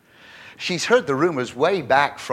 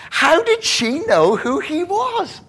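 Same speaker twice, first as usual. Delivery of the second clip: amused; 13 semitones higher, 3 dB louder, slower than usual. Real, not acted.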